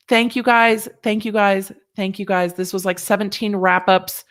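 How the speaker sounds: usual speaking voice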